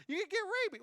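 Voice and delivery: warning listeners in a high squeal; squeaky warning. Acted. Warning about rabies, squeaky.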